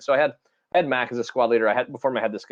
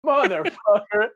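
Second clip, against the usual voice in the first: exasperated groan; 11 semitones higher, slower than usual. Retold or imitated. Reaction on recognizing caller.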